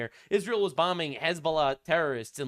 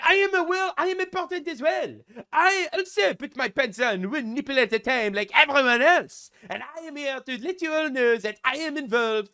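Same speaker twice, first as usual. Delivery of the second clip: high, loud hammed-up French accent; cartoon French accent, pitched way up; mock French accent; 8 semitones higher, 5 dB louder than usual. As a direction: play a self-important Frenchman in a French accent. Mocking Macron again.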